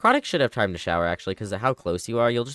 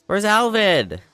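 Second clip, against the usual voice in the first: loud, much higher-pitched question; exasperated call; 9 semitones higher, 6 dB louder, slower than usual. Real, not acted. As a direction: call out in alarm for someone missing. Asks again, frustrated at missing character.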